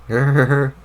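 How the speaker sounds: loud growl; riled dog-like growl. Acted. Jade riled up by the cat, loud growling.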